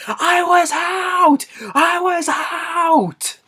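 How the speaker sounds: high, drawn-out mock shout; long wailing holler, play-acted; acted cry for help. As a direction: yell it out long and high, imitating a trapped man. Retelling, trapped man yelling out.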